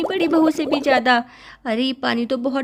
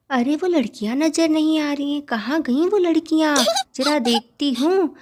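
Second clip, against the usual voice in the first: ordinary delivery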